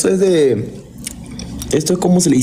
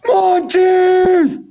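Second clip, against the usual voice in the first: excited shout; 11 semitones higher, much slower than usual. Real, not acted. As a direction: yell the name in triumph. Cheering a gifter live. (grito de euforia)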